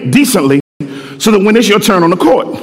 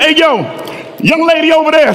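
higher casual call across the court; calling over; 8 semitones higher, faster than usual. Retold or imitated. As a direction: pick her from the sideline. God calls the young lady.

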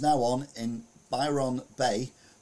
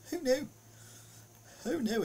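high surprised exclamation; 3 dB quieter than usual. Real, not acted.

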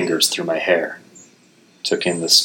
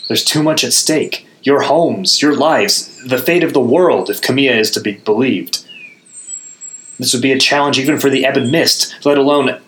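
urgent warning; 7 semitones higher than usual. A narrator's reading. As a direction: rush to warn them. Narrator urging companions.

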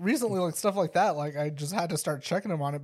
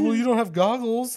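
put-on higher, louder kid challenge; imitated arguing child; 6 semitones higher, 5 dB louder than usual. Kid challenges dad about goggles, raised mock-child voice. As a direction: push back like an insistent little boy.

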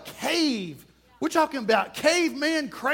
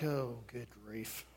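drawn-out, much softer and lower groan of "oh, good grief"; weary exasperated sigh; 12 semitones lower, 16 dB quieter, slower than usual. Retold or imitated. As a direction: sag into a tired, fed-up mutter. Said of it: "And I'm like".

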